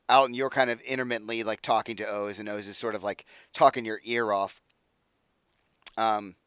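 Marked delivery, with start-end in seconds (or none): none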